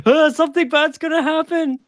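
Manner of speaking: high, loud squeal of dread; mock panic. Player yelps at the DM, a high mock panic squeal. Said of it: "I'm like".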